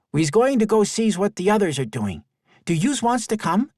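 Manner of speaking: put-on high, lively young boy; a kid inviting a friend along; child voice.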